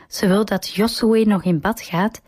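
usual speaking voice

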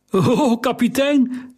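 high, wavering cry of alarm; old sailor calling up in shaky alarm; unsteady voice. A narrator's reading. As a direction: call out shakily, startled. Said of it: "riep hij met onvaste stem".

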